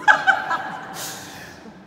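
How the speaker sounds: real high-pitched laugh at himself. Real, not acted. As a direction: burst into a high giggle. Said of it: "se me salió el colombiano". (risa aguda y real)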